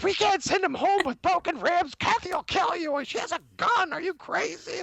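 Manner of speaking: high-pitched mock scolding; mad wife. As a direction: scold in an imitated frantic wife's high voice. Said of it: "she got so mad".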